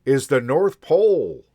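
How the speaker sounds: loud, high, hearty conductor; booming conductor. A narrator's reading. Conductor telling the boy the destination, loud and hearty. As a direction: announce it proudly like a conductor.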